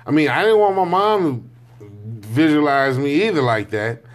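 usual speaking voice

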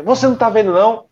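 high, loud mock snap at the driver; rude angry retort. Rude stranger snapping at the driver over the bumped car, high and loud. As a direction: play the rude stranger barking back. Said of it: "ele foi muito ignorante".

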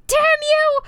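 high, loud cursing at husband; mock scream. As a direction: scream at Link through the pain. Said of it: "I screamed".

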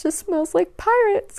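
wistful squeal. Retold or imitated. Smelling the mask from the ride.

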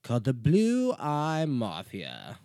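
slow, loud mock British statesman; a hammed-up British Churchill, drawn out; Winston Churchill impression. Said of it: "I will do this as Winston Churchill".

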